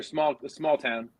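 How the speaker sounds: ordinary delivery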